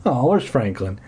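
ordinary delivery

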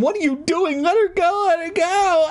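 very high frantic squealing. Acted. Mother tugging the puppeteer's arm, frantic. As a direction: squeal it as one rising panic. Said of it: "She began frantically tugging my arm".

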